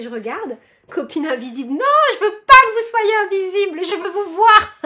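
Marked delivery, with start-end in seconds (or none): 1.7-4.8 mock pleading. Playful plea to unseen viewers.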